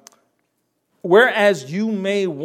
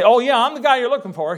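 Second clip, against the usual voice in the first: high, quick and louder offhand answer; mock casual reply; 9 semitones higher, 5 dB louder, faster than usual. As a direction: toss it off as a breezy, joking admission. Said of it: "He didn't just say".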